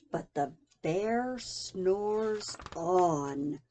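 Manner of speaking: drawn-out low sleepy line; slow sleepy refrain. A narrator's reading. Reading to child, slow sleepy refrain. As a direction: lull it like a snore.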